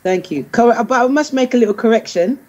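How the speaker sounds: usual speaking voice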